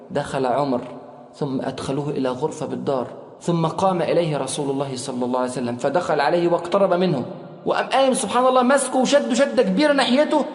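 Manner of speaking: ordinary talk, nothing marked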